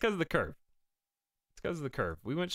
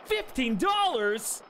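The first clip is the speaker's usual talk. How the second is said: high, slow disbelieving question; incredulous; 8 semitones higher, 4 dB louder, slower than usual. Real, not acted. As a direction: stretch it out in disbelief. Repeats price in disbelief.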